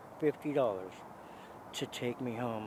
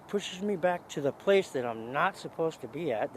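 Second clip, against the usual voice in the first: ordinary talk, nothing marked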